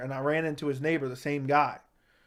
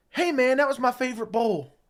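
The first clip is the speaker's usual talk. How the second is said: much higher, louder mock-whiny protest; a petty wounded squeak over a bowl; whiny complaint; 9 semitones higher, 4 dB louder than usual. Buddy's high whiny complaint retold.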